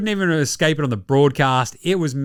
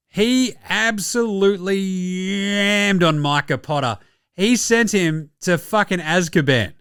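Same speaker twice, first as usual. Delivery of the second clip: normal talking voice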